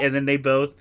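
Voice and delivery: ordinary delivery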